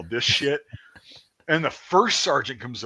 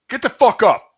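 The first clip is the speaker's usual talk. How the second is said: high, loud command; imitated angry shout; sergeant's shouted order; 11 semitones higher, 10 dB louder than usual. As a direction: yell it like a drill instructor. Drill sergeant ordering him to stand.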